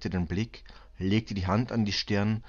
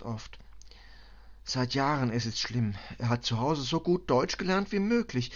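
higher-pitched, earnest foreign woman; troubled refugee mother; 6 semitones higher than usual. Acted. Refugee tells commissioner of war and husband, troubled and earnest. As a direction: recount hardship in broken German, with pained emphasis.

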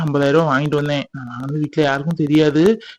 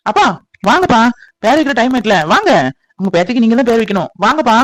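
calling over; 6 semitones higher, 3 dB louder than usual. Acted. Calling father to name baby.

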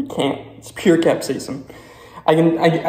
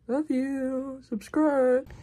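high, soft cutesy voice; cutesy sign-off; 11 semitones higher, 4 dB quieter, much slower than usual. Real, not acted. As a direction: chirp it sweetly. Cute sign-off to viewers.

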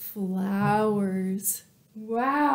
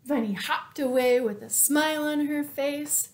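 plain reading voice

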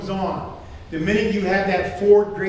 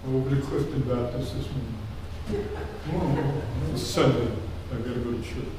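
bored churchgoer; 9 semitones lower, 5 dB quieter than usual. Retold or imitated.

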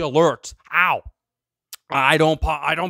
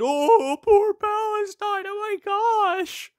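much higher, slow mock-sympathetic whine; mock pity; slower than usual. Mocking people who pity Palestine, in a high, slow whine. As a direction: mimic a gushing bleeding-heart sigh. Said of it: "before anyone just stand".

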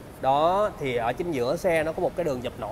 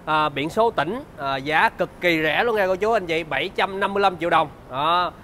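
bright, upbeat sales pitch; slick used car salesman; 3 semitones higher, 3 dB louder than usual. Acted.

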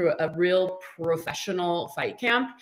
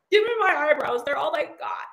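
mock wailing plea; 7 semitones higher than usual. Pleading for eyebrows, mock cry.

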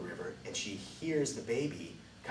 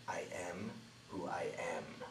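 solemn whisper; 3 semitones lower, 10 dB quieter than usual. Acted. God's name revealed, throat cleared.

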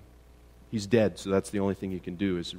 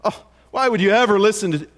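fast, higher, louder scoff; mock dismissive scoff; 9 semitones higher, 6 dB louder, faster than usual. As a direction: brush off their favourite teacher with disdain. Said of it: "If I were to say to you that".

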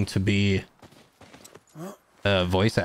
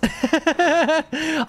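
high laugh. Real, not acted. Laughing at ghost line.

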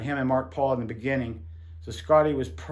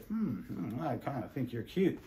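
flirty murmur; 3 semitones lower, 11 dB quieter than usual. Retold or imitated. Casting guy flirting.

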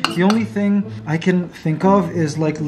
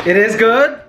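good rising, a touch louder; surprised question; 5 semitones higher, 4 dB louder than usual. Real, not acted. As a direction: echo it back in happy surprise. Repeating RJ's answer in surprise.

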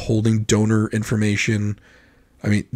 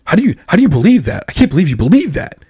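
higher, swooping, incredulous protest; mock indignation; 5 semitones higher, 4 dB louder, faster than usual. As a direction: imitate someone appalled by the other view. Mimics offended person reacting to disagreement.

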